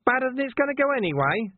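high, cocky villain voice; cheeky villain. A narrator's reading. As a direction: shrug it off cockily.

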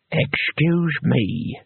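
slow, drawn-out and flat; flatly. A narrator's reading. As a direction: say it deadpan and slow. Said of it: "he said flatly".

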